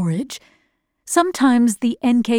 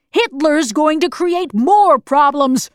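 higher, slow, emphatic retort; irritated warning; 5 semitones higher, 4 dB louder than usual. A narrator's reading. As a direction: hammer home a grim warning. Bald man's bitter warning.

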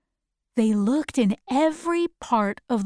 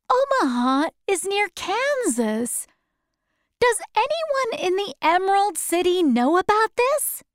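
higher, lightly voiced young Dorothy; curious girl; 10 semitones higher than usual. Dorothy asking Oz if the city knows, light higher voice. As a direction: ask in a bright higher girl's voice.

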